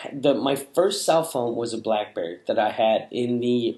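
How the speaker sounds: normal talking voice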